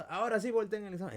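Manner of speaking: loud, high teacher's order; stern teacher. Retold or imitated. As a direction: bark the order like a strict professor. Profesor da orden en clase. (profesor dando una orden en voz alta)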